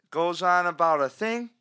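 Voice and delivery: usual speaking voice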